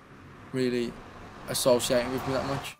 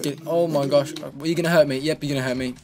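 normal talking voice

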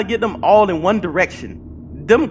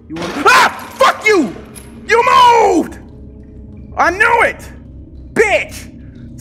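high, loud startled yelling; scared scream; 13 semitones higher, 3 dB louder, slower than usual. Real, not acted. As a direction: shriek after a jump scare. Jump scare, screaming at enemies.

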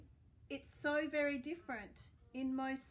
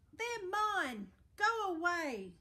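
higher and louder, "mine" snapped with a falling tune; grumpy pug shouting; 5 semitones higher, 5 dB louder, slower than usual. Acted. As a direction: bark it at Trevor like a spoiled pug. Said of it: "Pig would just grumble".

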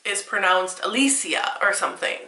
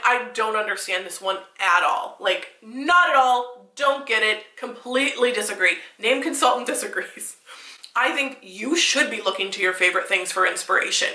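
normal talking voice

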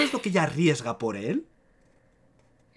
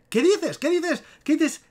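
high squeaky-pitched nagging imitation; mock complainers; 13 semitones higher, slower than usual. Commenters challenge his pronunciation, high mocking imitation. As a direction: imitate indignant commenters in a much higher voice. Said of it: "Y me escriben".

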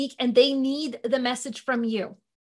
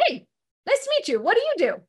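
bright higher friendly greeting; friendly small talk voice, higher; party small talk; 7 semitones higher, faster than usual. Acted.

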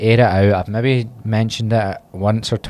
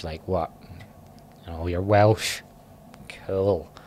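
put-on Welsh accent; 6 dB quieter, slower than usual. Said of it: "the best Welsh accent I've ever done".

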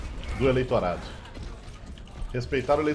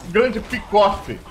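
loud, higher hype calls; excited celebration; 5 semitones higher, 6 dB louder, much slower than usual. Real, not acted. Streamer celebrating his kill, loud excited calls. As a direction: crow over the kill.

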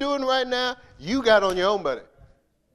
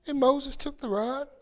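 normal talking voice

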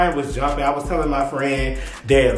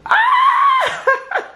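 delighted squeal; 3 dB louder than usual. Real, not acted. Delighted by finished decor.

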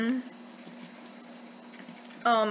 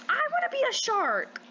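eager; 11 semitones higher than usual. Real, not acted. Choosing the next shark.

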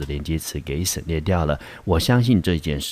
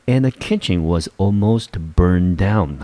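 slowly, every word laid out for learners; slow careful reading. Shown as a demonstration. Teacher slowly rereads the sentence for the class, slow and careful. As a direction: read it slowly so students catch each word.

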